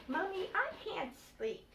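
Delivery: put-on high, small child's plea; a child begging to stay up; little bunny voice. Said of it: "said her youngest child".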